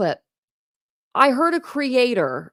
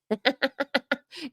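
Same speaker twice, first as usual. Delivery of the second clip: soft, higher-pitched chuckle; mocking laugh; 6 semitones higher, 7 dB quieter than usual. Real, not acted. Reactor mocking a streamer's legal troubles, soft chuckle. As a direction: chuckle quietly at your own jibe.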